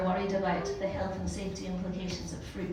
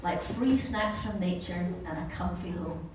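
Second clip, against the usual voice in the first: usual speaking voice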